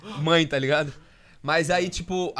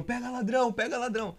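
higher-pitched imitation of a man crying out; retold cry of 'stop thief', pitched up; mock yelling; 6 semitones higher than usual. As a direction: mimic a street alarm without full volume. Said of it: "um gordinho, um senhorzinho, gritando".

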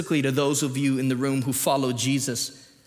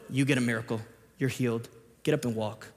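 low, soft, bored mock cashier; deadpan cashier; 4 semitones lower, 5 dB quieter, faster than usual. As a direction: hand out miracles like a cashier waiting out a shift. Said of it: "like a, a deadpan cashier who's waiting for their shift".

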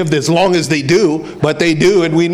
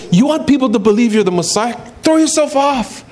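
lightly voiced taunting devil, pitched up at the end; tempting devil; 5 semitones higher than usual. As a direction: goad him like a tempter, lightly acted. Voicing the devil tempting Jesus.

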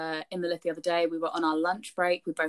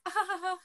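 high, soft mock-polite laughter; a forced British courtesy laugh; polite fake laugh; 12 semitones higher, 5 dB quieter, much faster than usual. Retelling how housemates humour a neighbour in the lift, polite fake laugh. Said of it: "Us being British and polite".

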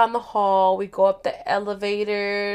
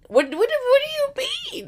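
high-pitched stammering indignation; mad, retold. Her mad at him on the call, high indignant stammer. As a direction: stammer the question way up high, outraged. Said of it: "I remember feeling so mad".

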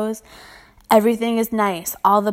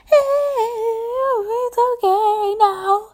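high, slow mock of an old lady; older lady brush-off; 12 semitones higher, slower than usual. Imitating an older lady turning down her help, high and slow. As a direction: dismiss her sweetly and slowly. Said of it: "older ladies".

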